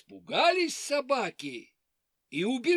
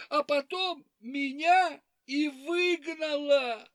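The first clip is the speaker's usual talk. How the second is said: high weepy hare voice; 6 semitones higher than usual. Acted. Hare complains, crying.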